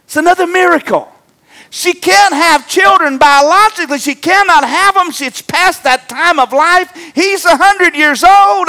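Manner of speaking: high, loud, fervent preaching; preaching at full force. Acted. Pastor preaching a miracle to the church, at full force. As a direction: lift the congregation with a rising preacher's cry.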